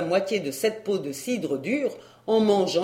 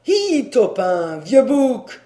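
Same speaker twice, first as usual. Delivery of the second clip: shouted call; 2 semitones higher, 5 dB louder, slower than usual. A narrator's reading. Joson hails the horse Taupin, loud shouted call. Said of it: "il cria".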